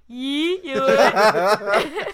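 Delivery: loud, high, laughing call; imitating the brother. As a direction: belt it like the brother does. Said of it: "It's like".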